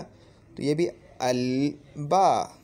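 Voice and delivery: अल बा said very slowly, the l kept clear; slow demo. Shown as a demonstration. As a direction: stretch अल बा out. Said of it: "ये भी देखो दांत के पीछे से नहीं निकल रहा".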